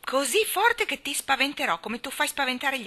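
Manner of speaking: a furious small voice; furious little voice. A narrator's reading. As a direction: threaten to outshout him. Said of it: "vocetta furiosa".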